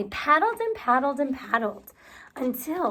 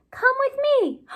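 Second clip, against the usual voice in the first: eager invitation; 11 semitones higher, 4 dB louder than usual. Acted. Beaver invites duck.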